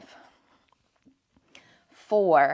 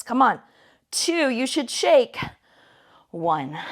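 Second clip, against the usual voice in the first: pumped-up coaching; 4 semitones higher than usual. Real, not acted. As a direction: fire them up to hold on. Coach pushing a hard hold.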